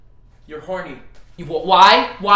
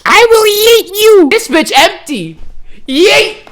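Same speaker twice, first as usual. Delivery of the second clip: mock threat; 10 semitones higher, 4 dB louder, slower than usual. Playful threat while tickled.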